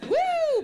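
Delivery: high, loud "woo"; whoop. Real, not acted. Host hyping the open mic crowd, loud whoop. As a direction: let out a big high whoop.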